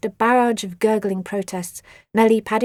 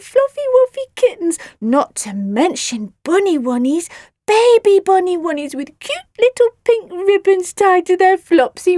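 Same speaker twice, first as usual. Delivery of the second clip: put-on baby talk; 8 semitones higher, 6 dB louder than usual. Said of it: "continued Nellie".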